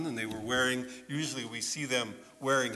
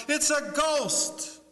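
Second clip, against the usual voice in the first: high, slow, drawn-out cry of fright; frightened yell; 11 semitones higher, much slower than usual. Retold or imitated. Disciples in the boat spotting a shape, a slow high frightened cry. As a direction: stretch out the scream of a ghost sighting. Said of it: "and they yell out".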